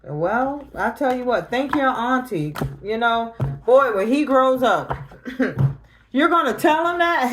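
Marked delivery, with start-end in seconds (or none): 3.6-7.3 higher and louder, half laughing; incredulous mock outrage; rising outrage. Real, not acted. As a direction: sputter it out in disbelief. Mocking the family, voice rising.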